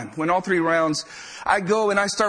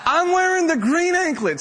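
higher, louder, incredulous punchline; excited emphasis; 11 semitones higher, 3 dB louder than usual. Real, not acted. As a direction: belt the twist out in disbelief. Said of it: "repeated with bigger emphasis".

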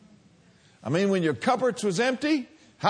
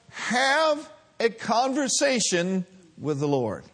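ordinary talk

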